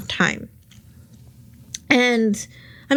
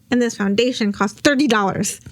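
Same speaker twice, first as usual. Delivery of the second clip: usual speaking voice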